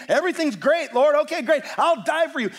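eager, overconfident pledge; loud, high-pitched burst of bravado; Peter. Acted. Peter pledges his life to the Lord, eager bravado. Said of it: "as long as it's about Peter".

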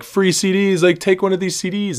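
mock a CD seller working the crowd; imitated street hawker. Imitates mixtape seller calling out.

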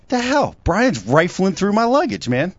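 high, exasperated disbelief; mock outrage. Telling friends about his luggage, high mock outrage. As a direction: recall finding a friend digging through your bag, incredulous.

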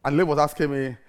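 ordinary talk, nothing marked

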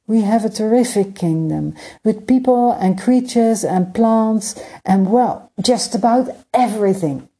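put-on a polite young prince, earnest and hopeful; young prince Emir. Prince Emir explaining his crowded kingdom to Skymaker, light boyish voice.

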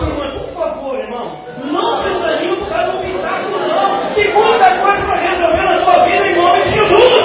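impassioned preacher's cry, slowing at the end; preaching at full force. Real, not acted. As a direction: shout the appeal to the congregation.

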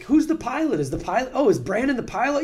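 high, loud, eager passenger; mock excited confusion. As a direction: puzzle it out with rising excitement. Excited passenger catching on, retold.

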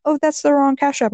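high, sweet, fussy; scammer voice. Retold or imitated. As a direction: correct him, all sugary. Voicing scammer.

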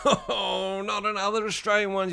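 high whiny imitation of a listener; mock complaint. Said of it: "you say, Mark?".

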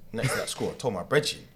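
ordinary talk

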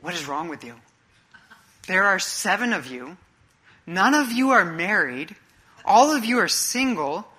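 exasperated dad. Retold or imitated. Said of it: "Dad's like".

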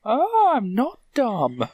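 high, slow, drawn-out mock voice; mock relief. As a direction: tease the friend's aha. Mocking friend's realization.

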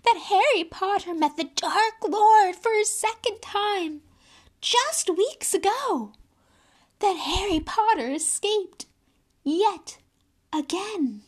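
high, loud elf voice; awed elf recounting the hero's deeds; high house-elf voice. A narrator's reading. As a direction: elf telling Harry of his escape.

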